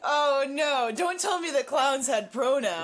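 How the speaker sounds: loud hammed-up gasp of horror; overplayed dismay, loud; mock shock.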